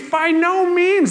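high, slow, loud exasperated cry; Paul throwing up his hands at a silly question. Retold or imitated. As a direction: scold them like Paul, fed up. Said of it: "he does the voice in the Bible".

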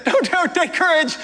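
high, lightly voiced reassurance, slowing at the end; reassuring. Jesus calming his terrified disciples, mildly reassuring. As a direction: soothe frightened friends with a half-smile. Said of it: "Jesus immediately said to them".

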